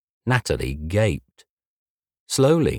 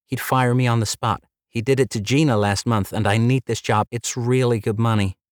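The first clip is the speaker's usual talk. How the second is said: lightly voiced polite receptionist, higher; a hint of a bright front-desk girl, pitched up; 5 semitones higher than usual. Red-haired receptionist greeting Jay, lightly higher polite voice.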